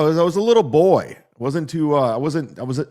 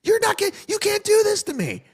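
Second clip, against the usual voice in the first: much higher squeaky mock-woman voice; mocking booker. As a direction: whine it like the frantic booker. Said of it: "She's like".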